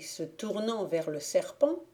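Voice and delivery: plain reading voice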